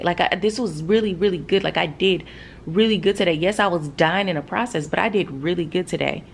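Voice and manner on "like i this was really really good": usual speaking voice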